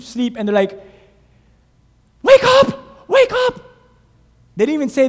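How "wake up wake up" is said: very high, loud frantic shout; frantic yell. Acted. Disciples shaking sleeping Jesus awake, frantic shout. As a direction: scream him awake.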